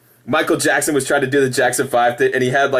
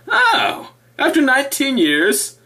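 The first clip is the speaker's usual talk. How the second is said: high mocking husband voice; 8 semitones higher than usual. Mocking the converted husband, high voice. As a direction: mimic the husband, pitched well up. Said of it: "until their husband said".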